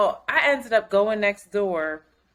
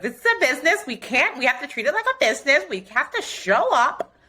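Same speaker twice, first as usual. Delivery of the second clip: higher, fast mock pep talk; lecturing herself; 6 semitones higher than usual. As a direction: play the strict boss of your own show. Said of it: "'Cause you know I'm like".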